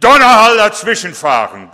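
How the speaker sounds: booming sudden shout like a thunderclap; thunderous shout. Shown as a demonstration. Pastor booming at the congregation to show thunder, loud shout. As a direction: roar it out to mimic the thunder. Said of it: "das war zu laut".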